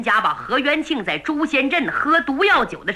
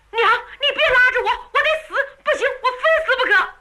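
mock shouting; 11 semitones higher, faster than usual. A narrator's reading. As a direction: shout to frighten her, half playing. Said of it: "何凤嘴里喊得挺厉害".

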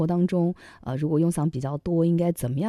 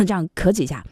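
throat clearing. Shown as a demonstration. (清嗓子示范)

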